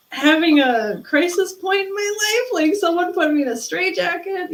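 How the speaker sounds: high, loud rush of alarm; panicked. Retold or imitated. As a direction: voice climbing in disbelief. Retelling inner panic.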